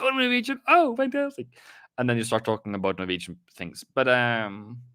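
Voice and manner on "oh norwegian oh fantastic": much higher, gushing delight; thrilled gush. Retold or imitated. Expat meeting a Norwegian in a store, gushing much higher. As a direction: gush like you just met family abroad.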